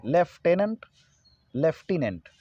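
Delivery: "लेफ्टिनेंट" as lef-te-nant, rising, then lef-ti-nent, falling; two separated takes of "लेफ्टिनेंट" with an f; correct lieutenant. Shown as a demonstration. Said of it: "ये होना चाहिए". ("लेफ्टिनेंट" का सही उच्चारण, लेफ-टेनंट, लेफ-टिनेंट)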